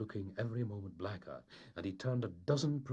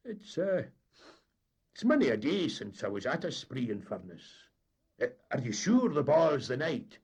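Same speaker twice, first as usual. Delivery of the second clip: lightly raised, hesitant skipper; wavering captain; 7 semitones higher, 8 dB louder than usual. A narrator's reading. Captain checking with the mate, a touch hesitant. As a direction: let the doubt give way.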